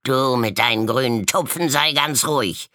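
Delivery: high, loud snapping retort; shouted retort. A narrator's reading. Spotted raven retorting to the pink flock, loud. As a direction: shut the other up. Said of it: "tönte es zurück".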